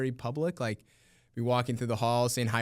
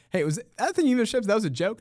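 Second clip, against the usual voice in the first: put-on kids asking; 11 semitones higher, 5 dB louder, faster than usual. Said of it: "they're like".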